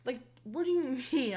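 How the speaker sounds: high-pitched mock outrage; sarcastically baffled retort; sarcastic. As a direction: play up fake bewilderment, pitch way up. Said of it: "I was, like, being sarcastic".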